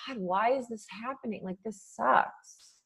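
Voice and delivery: sad and hurt. Retold or imitated. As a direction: murmur it quietly, as if worn down. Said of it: "you're really sad and you're really hurt".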